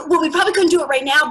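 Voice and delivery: fast, loud, tumbling enthusiasm; rushed eagerness. Retold or imitated. Comic pitching an igloo plan in a breathless rush.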